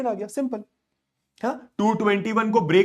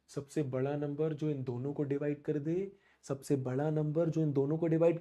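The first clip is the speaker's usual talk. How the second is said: soft, lower, hushed repeat; 4 semitones lower, 10 dB quieter than usual. Shown as a demonstration. Teacher softly repeating question as students close eyes. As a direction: let it sink in quietly. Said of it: "आंखें बंद कर".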